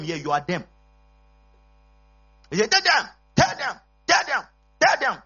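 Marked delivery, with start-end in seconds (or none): 2.7-5.2 high, drawn-out calls; mimicked urging. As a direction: urge it insistently, pitched well up. Mimics congregant urging others to listen.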